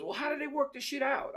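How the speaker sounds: very high-pitched imitated question; inquisitive gang boss. Gang boss asks how buying works, high-pitched puzzled retelling. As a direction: play the puzzled boss well above your own pitch.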